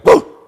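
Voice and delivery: short explosive dog bark; one loud, abrupt imitated woof; sudden loud woof. Whip making the puppy back up, sudden loud woof. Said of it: "Whip's gonna go".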